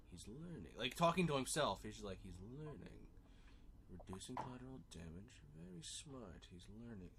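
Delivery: soft low muttered scheming; villain muttering plans aloud. Retold or imitated. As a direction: mutter to yourself like a plotting villain. Luthor muttering to himself.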